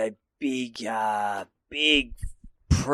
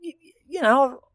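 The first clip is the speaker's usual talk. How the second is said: defensive plea; 9 semitones higher than usual. Retold or imitated. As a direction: plead with the boys. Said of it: "And I'm like".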